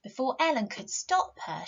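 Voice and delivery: usual speaking voice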